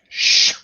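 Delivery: sharp hissed shush; imitated urgent "shh". Soldier hushing the next tent, sharp shush.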